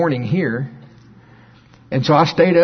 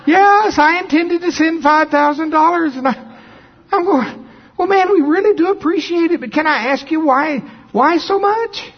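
much higher and louder, swept up in the story; excited preaching; 4 dB louder than usual. Acted. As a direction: preach it out with rising wonder. Preacher's story climax, shouting.

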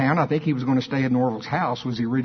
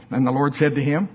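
ordinary talk

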